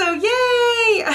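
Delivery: high, bright cheer; thrilled shout of yay; excited yay. Real, not acted. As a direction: squeal with real joy. Finds Hogwarts letter.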